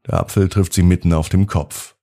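ordinary delivery, nothing marked

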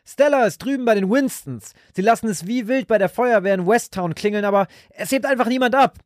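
wife reporting the fire brigade won't answer, frantic; anxious calling. A narrator's reading. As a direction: call the news over, frantic. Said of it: "seine Frau rufen".

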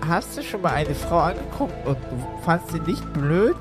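ordinary delivery

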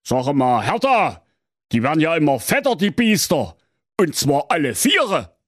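put-on father lecturing his teenage daughter; stern father. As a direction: scold her like a know-it-all father. Father notices fat mice.

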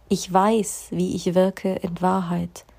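slow, deliberate affirmation; slowly affirming Ich weiß, wie ich wirke. Shown as a demonstration. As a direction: let each word land slowly. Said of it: "Da geht's darum".

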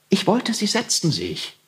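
calming request. A narrator's reading. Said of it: "sagte Heinrich".

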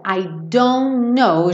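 I don't know slowly run together, "I dono"; teacher links I don't know slowly; linked, slow. Shown as a demonstration. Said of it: "já falei aqui, né, que eu ia juntar".

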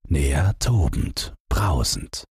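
ordinary delivery, nothing marked

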